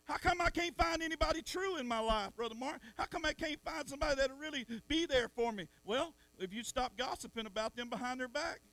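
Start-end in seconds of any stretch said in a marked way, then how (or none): 0.0-5.7 voiced complaint. Preacher voicing lonely churchgoer.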